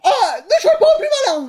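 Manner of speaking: high, theatrical telling-off; indignant scolding. Acted. Parent answers son's cheeky joke, indignant.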